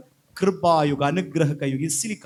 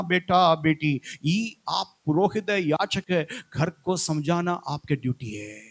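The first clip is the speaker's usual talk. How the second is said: ordinary talk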